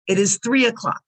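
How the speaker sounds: usual speaking voice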